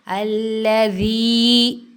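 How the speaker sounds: अल्लज़ी with ज़ी held one count; ya madd. Shown as a demonstration. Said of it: "वी पर याय मद्दा हुई है". (अल्लज़ी में याय मद्दा)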